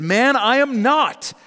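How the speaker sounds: high, emphatic acted protest; vehement denial. Peter's heated second denial.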